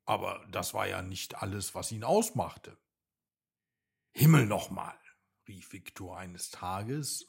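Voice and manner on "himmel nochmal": louder, exasperated exclamation; frustrated outburst. A narrator's reading. Little bird Viktor, fed up with his labels, frustrated outburst. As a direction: burst out, fed up at last. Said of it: "als seine Frustration ein Höchstmaß erreichte".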